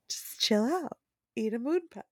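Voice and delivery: quietly mimicked judge telling someone to settle; lightly voiced judge, well under normal volume; imitating calming judge. Retelling a TikTok judge telling a kid to calm down, softly imitated. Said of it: "like a judge telling some kid to calm down".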